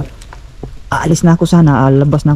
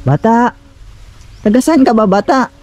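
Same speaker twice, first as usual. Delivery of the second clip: raised, questioning call; 9 semitones higher than usual. Real, not acted. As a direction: call out across a distance, voice raised high. Calls out to child.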